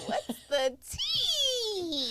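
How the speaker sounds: very high, slow, laughing shout; crazy voice fluctuation. Acted. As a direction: squeal "What's the tea?" high and slow. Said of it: "That's my crazy voice fluctuation".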